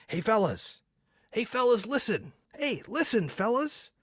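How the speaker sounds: high pleading little voice; whiny little guy. Retold or imitated. Host voicing the little guy nagging the fellas, high whiny voice.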